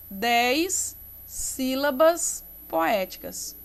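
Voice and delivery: drawn-out teacher's emphasis with pauses; unhurried, spaced-out words for the class; slow, deliberate. Shown as a demonstration. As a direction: spell it out slowly, word by word. Teacher dictates term slowly.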